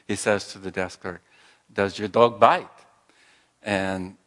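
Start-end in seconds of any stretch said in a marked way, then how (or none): 1.7-2.7 put-on polite question in a French accent; lightly put-on French accent, asking calmly; Clouseau French accent. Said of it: "Clouseau in his French accent says".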